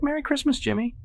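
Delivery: very high falsetto squeal; shrieked greeting. A narrator's reading. Evelyn greeting another guest, shrieking high. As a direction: squeal the holiday wish excitedly. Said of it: "she shrieks".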